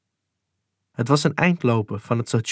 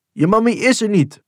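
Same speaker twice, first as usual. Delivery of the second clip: higher and louder harsh reply; shouting back; 9 semitones higher, 5 dB louder than usual. Retold or imitated. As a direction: call it back at the child sharply. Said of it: "riepen ze en sloegen opnieuw". (luid terugroepen)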